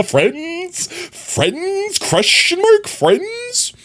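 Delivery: very high falsetto "Friends?"; playful falsetto question; squeaky voice. Pretend. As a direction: pipe it up like a cartoon. Silly squeaky repeated question.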